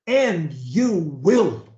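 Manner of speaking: very slow, each word spaced for repeating; slow dictation. Shown as a demonstration. Coach leading a client in repetition, very slow. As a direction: say it slowly for them to copy. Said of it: "say after me".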